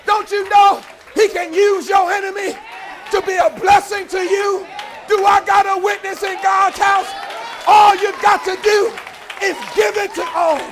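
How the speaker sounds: high, loud whooping sermon; rousing preacher calling for a witness; full-force preaching. Acted. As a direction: preach it out to the congregation.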